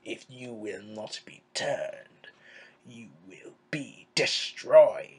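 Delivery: low, slow, hushed villain impression; serious Palpatine. Evil overlord threatening a Jedi, mock-serious and slow. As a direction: start in a near-whisper and build to a firm, low threat. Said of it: "then one minute he becomes serious".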